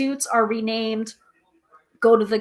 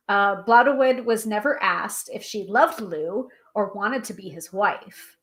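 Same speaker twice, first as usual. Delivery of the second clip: normal talking voice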